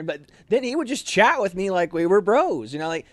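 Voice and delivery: usual speaking voice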